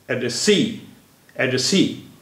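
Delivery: Address सी with सी strongest, first syllable lighter; primary stress on सी. Shown as a demonstration. Teacher demonstrating primary and secondary stress, सी stressed most in address सी. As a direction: lean hardest on the final सी in address सी. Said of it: "सी पर ज़्यादा जोर दिया".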